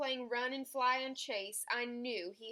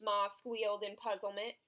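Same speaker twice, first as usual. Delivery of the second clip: ordinary delivery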